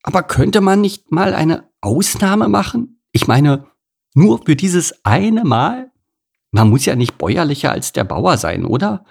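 high, wheedling plea; reluctant cow, pitch swinging up; hemming and hawing. A narrator's reading. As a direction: squirm and bargain for an exception. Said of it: "druckste Kuni".

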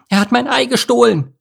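very high, agitated screech; screeching seagull. A narrator's reading. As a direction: cry out as a frantic gull lady. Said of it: "kreischte eine aufgeregte Möwendame".

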